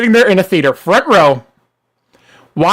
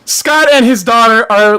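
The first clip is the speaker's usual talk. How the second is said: normal talking voice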